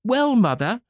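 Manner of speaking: high, cheeky answer; pert reply. Acted. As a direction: snap back rudely. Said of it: "answered the pert hussy".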